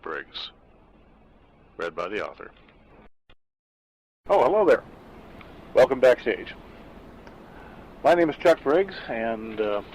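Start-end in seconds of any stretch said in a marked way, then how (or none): none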